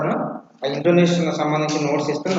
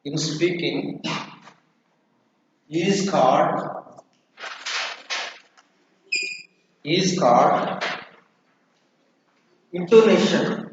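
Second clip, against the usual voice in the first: slow, measured classroom dictation; 5 dB quieter, much slower than usual. Real, not acted. Teacher dictating intonation notes for students to write, slow dictation. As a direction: dictate in short repeated chunks with long pauses.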